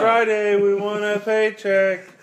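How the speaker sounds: high, loud, drawn-out holler; comic calling out. Acted. Survivor calling out for a Friday paycheck, comic holler. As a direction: call into an empty place, stretching it out. Acts out primitive people calling out.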